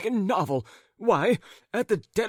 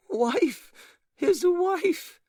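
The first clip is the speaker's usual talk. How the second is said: much higher, teary laughing cry; 3 dB louder, much slower than usual. A narrator's reading. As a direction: shriek it through tears of laughter. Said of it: "cried the lady ... through laughing".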